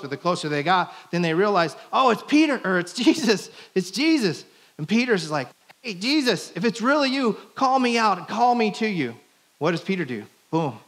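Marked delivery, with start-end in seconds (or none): 1.9-4.4 high, loud cry of discovery; excited recognition. Retold or imitated. Disciples spotting someone walking on the water, excited. 5.8-9.1 high-pitched call across water. Retold or imitated. Peter calling to Jesus from the boat, calling out.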